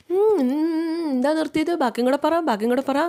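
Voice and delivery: acted needling, daring them to continue; taunting prod. Acted goading to keep talking.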